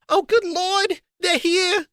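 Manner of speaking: very high, panicked aunt; flustered squeal as guests arrive; frantic. A narrator's reading. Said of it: "said Aunt Petunia frantically".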